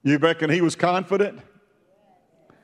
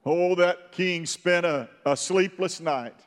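ordinary delivery

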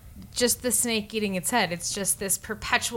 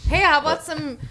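high, loud hammy Canadian; Canadian accent; 6 semitones higher, 4 dB louder than usual. Pretend. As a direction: ham up a cheerful Canadian. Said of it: "learn a Canadian accent".

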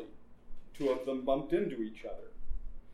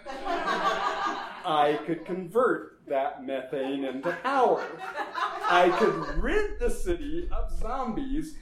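slow, louder, cackling mad scientist boast; gloating laugh; 4 semitones higher, 5 dB louder, slower than usual. Acted. Doctor boasting of his zombie power plan, laughing. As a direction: savour the plan with a villain's laugh.

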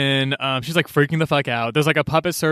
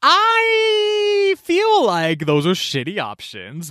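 exasperated shout; 11 semitones higher, much slower than usual. Retold or imitated. Leland stuck with only bad options, slow and much higher. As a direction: yell the complaint slowly.

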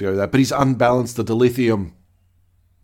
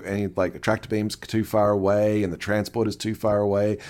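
ordinary delivery

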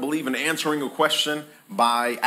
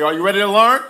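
higher, louder rousing call; louder call to the crowd; 7 semitones higher, 8 dB louder, faster than usual. Shown as a demonstration. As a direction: pump the room up. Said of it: "Let's try it again".

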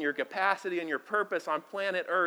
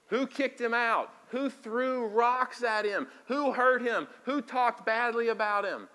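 preaching; 6 semitones higher, 3 dB louder than usual. Acted. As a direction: press each question on the church. Preacher rhetorical questions.

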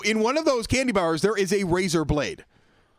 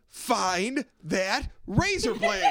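game show announcer; 2 semitones higher, 4 dB quieter, much slower than usual. Acted. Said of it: "it was called".

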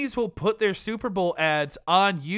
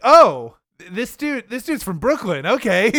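surprised realization; 4 semitones higher, 7 dB louder than usual. Retold or imitated. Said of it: "and I'm like".

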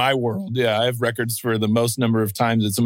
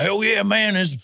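put-on grumbling old racer; 6 semitones higher than usual. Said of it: "And like".

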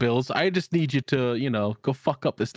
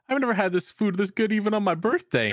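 higher, laughing through the quote; laughing mock Jaden; 7 semitones higher than usual. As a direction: quote him between laughs, pitched up. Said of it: "He's like".

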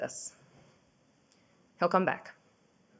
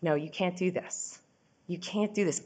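retold quiet pleading; 7 dB quieter than usual. To her husband who is packing up to leave, quietly pleading. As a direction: plead softly, as if he's already leaving. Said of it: "I start begging and pleading with him".

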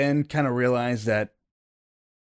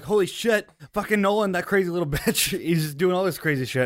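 higher-pitched, lightly exasperated outburst; mock exasperation; 6 semitones higher than usual. Player reacts to companion's crazy stunt, mildly exasperated. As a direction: blurt it high, half laughing.

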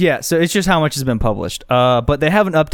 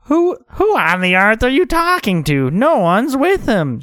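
high, drawn-out woman character; baffled neighbour woman; 8 semitones higher, slower than usual. Acted. Woman questioning someone talking to nobody, high baffled voice. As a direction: voice a puzzled neighbour, pitched way up.

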